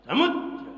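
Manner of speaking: समुद्र with a sharp breath push; forceful breath. Shown as a demonstration. Teacher demonstrating समुद्र with a kapalbhati push. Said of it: "कपालभाति करिए".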